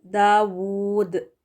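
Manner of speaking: दाऊद drawn out very slowly with a long middle; slow elongated demo. Shown as a demonstration. Teacher elongating the word for the class. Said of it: "तो क्या हुआ ये".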